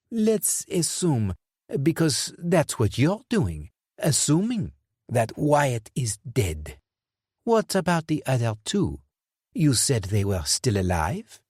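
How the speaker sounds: slow sardonic villain; tired, menacing Dufour. Acted. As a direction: press him with cold patience.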